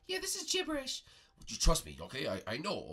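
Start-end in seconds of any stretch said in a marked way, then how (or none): 0.1-1.0 high falsetto princess; Princess Peach. Pretend. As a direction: chime in with a squeak. Peach comments.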